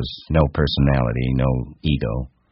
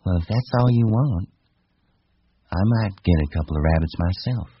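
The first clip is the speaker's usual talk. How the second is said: higher-pitched woman's character voice; high female character voice, flirting; coaxing wife; 6 semitones higher than usual. Acted. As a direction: play the wife, pitched up and casual.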